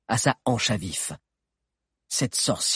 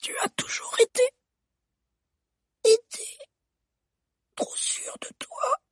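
breathless broken whisper; dying stammer. Acted. Strangled queen gasps at Eugénor, whispered and broken. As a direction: gasp out the words while being strangled. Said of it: "redouilla la reine".

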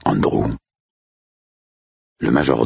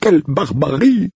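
high, louder, horrified cry; horrified cook; 4 dB louder than usual. Acted. Cook appalled at the cat's deed, high horrified cry.

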